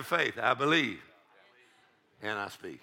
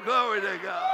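usual speaking voice

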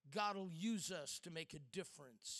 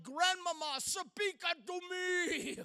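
higher, louder, slow mock-biblical plea; mock old-fashioned plea; 10 semitones higher, 7 dB louder, slower than usual. Grandson begging silent grandmother on the phone, hammed-up biblical plea. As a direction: ham it up like an old King James plea. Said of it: "I'm finally like".

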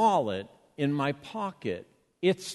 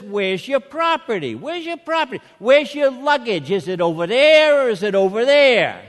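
put-on loud, high, exasperated Revere accent; 7 semitones higher, 11 dB louder than usual. Security guy repeats himself to baffled traveler, shouted Revere accent. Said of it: "had an accent a lot like mine".

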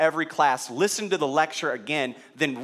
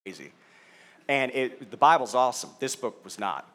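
usual speaking voice

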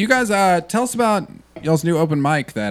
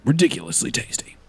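movie trailer voice; 4 dB quieter than usual. Pretend. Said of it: "read it like a trailer?".

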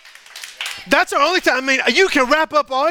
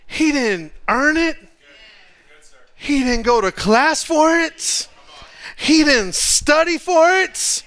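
slow, each line rising higher; slow emphatic build; 4 semitones higher, slower than usual. Real, not acted. To the congregation about the son, building emphatic list. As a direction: hammer each phrase slower.

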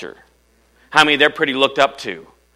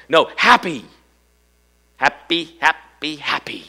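HAPPY raised in pitch each time, separately punched; insistent repetition; 4 semitones higher, slower than usual. Shown as a demonstration. Preacher correcting solemn idea of joy, insistent high HAPPY.

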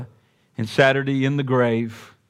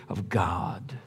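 slow, breathy whispered verse; 8 semitones lower, 12 dB quieter than usual. Acted. Preacher reminding the congregation of their failings, slow whisper. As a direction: hush it like a confession. Quiet close to a point.

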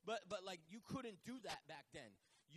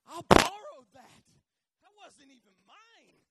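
high, drawn-out wail, hugely loud at first; crying out; 7 semitones higher, 26 dB louder, slower than usual. Acted. Man owning up about a borrowed axe, crying out. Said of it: "He cries out".

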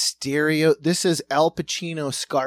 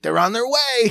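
put-on Cuban accent; 7 semitones higher, 6 dB louder than usual. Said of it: "He's like".

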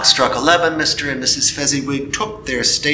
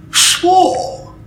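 high, sharp indignant retort; 6 dB louder than usual. Acted. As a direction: scoff at the word. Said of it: "Scrooge was indignant".

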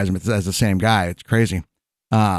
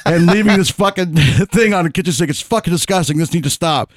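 higher-pitched imitation of an exasperated girlfriend; mock fed-up rant; 7 semitones higher, 3 dB louder than usual. Girlfriend confronting comic over the dirty towel, mock exasperated. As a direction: act out her exasperated outburst, amused.

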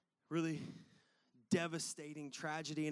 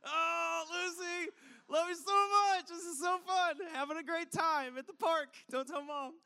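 high, loud, fake-happy singsong over panic; forced cheerfulness; 10 semitones higher, 3 dB louder than usual. Worried dad pretending all is fine at the park, high and forced bright. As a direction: sound breezy so the other moms don't notice. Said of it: "still trying to keep it light".

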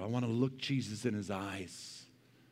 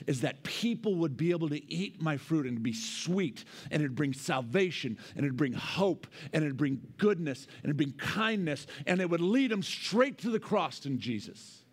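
building preaching; 5 semitones higher than usual. Acted.

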